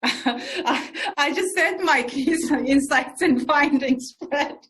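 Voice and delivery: joking protest broken up by laughter; laughing. Real, not acted. Jokes with interviewer, laughing.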